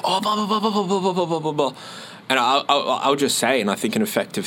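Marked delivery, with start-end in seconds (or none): 0.1-1.7 chattering on. Retold or imitated. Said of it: "straight away they'll be like".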